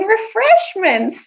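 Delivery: a drawn-out, high mock yell of refreshments. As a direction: call out refreshments slowly like a festival crier. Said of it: "He gets yelling".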